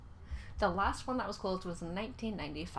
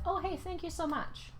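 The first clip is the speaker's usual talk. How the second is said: higher, sweet thank-you to a stranger; bright polite reply; 9 semitones higher than usual. Retold or imitated. Retelling her reply to a stranger, high and sweetly polite. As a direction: chirp it back politely.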